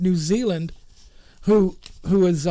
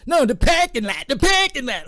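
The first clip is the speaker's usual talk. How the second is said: Boston local; 6 semitones higher, 4 dB louder than usual. Retold or imitated.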